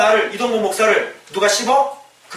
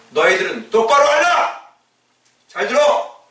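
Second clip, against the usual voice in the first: higher, stern call to listen; bold command; 6 semitones higher than usual. Retold or imitated. Preacher voices Peter commanding the gathered council, stern. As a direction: order the council to hear. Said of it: "이렇게 얘기합니다".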